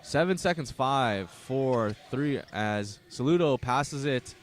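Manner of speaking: play-by-play announcer calling the first half; live play-by-play. Acted. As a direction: keep the game call going. Live basketball play-by-play call.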